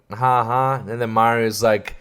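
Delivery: ordinary talk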